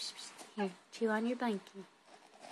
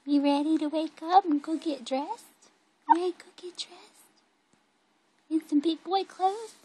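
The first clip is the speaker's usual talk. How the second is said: high, lifted, eager questions; 7 semitones higher, 7 dB louder than usual. Real, not acted. Coaxing baby to get dressed.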